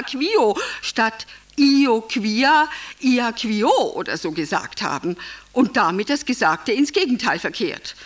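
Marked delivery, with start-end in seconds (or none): none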